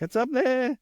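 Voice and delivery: very high, squeaky mock panic; panicked crew voice. Crew pointing the new guy to the board, squeaky mock panic. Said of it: "And they're like".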